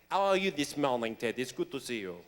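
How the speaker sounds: low, mock French greeting; fake French accent. College acquaintance greeting Ted in a fake French accent. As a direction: put on a phoney French accent, dropping low. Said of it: "with a fake French accent".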